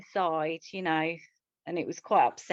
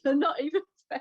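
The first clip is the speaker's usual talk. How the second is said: fast, higher, laughing and crying through "You're not even Fred"; laughing through tears; 8 semitones higher, faster than usual. Real, not acted. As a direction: repeat it quickly as the laugh-cry takes over. Repeats while breaking down.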